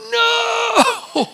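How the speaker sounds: high comic shout of refusal; mock child's no. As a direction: shout no like the clinging boy. Congregant refusing to let go.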